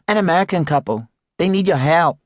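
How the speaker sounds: voiced Ying. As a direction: play the cheerful young shop assistant. Ying's line in dialogue.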